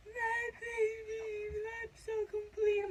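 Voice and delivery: very high, drawn-out mock sobbing voice; retold bawling. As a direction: re-enact tearful bliss in a stretched squeal. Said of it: "I'm bawling".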